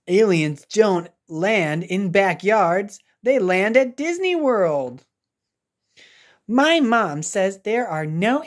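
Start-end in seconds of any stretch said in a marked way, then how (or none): none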